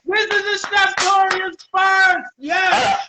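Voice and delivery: high, loud, stretched-out shout; triumphant excitement. Real, not acted. Host announcing the show's first, elated. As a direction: proclaim it slowly, at full voice. First live guest on show.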